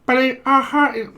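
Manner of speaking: high squawky phone voice; garbled caller. Acted. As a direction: squawk the greeting down a bad line. Garbled caller to call centre.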